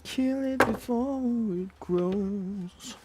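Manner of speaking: high, very slow eerie voice; creepy quote voice. Retold or imitated. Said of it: "He said".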